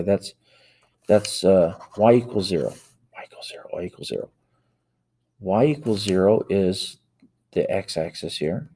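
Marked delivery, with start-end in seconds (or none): 3.1-3.7 whispered "Y equals zero" under the breath. Real, not acted. Teacher mutters the equation to self while drawing, whispering. 3.7-4.3 a much softer "y equals zero"; quietly to self. Real, not acted. Teacher repeats the equation to self, quiet.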